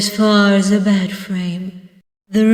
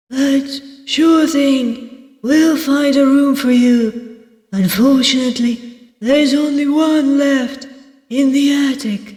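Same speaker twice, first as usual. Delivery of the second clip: oblige the guest in a raised character voice; obliging innkeeper; 6 semitones higher than usual. Acted. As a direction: inn owner pitched well up. Voices innkeeper Barrow in a story.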